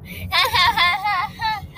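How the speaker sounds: high, manic giggle; sugar-high laugh. Acted. Rat answering Dragon while sugar high, high manic laugh. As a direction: cackle in a high sugar rush.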